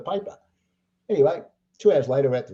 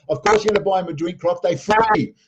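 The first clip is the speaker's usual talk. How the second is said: imitating a friend, loud and higher; loud mock heckle; 6 semitones higher, 6 dB louder than usual. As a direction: shout the punchline as the ribbing friend. George's heckle acted out, punchline.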